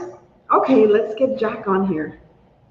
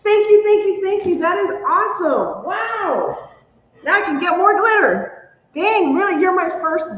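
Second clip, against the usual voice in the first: high, delighted thanks; real giddy gratitude, pitched up; 8 semitones higher than usual. Real, not acted. Streamer thanking her first donor, delighted.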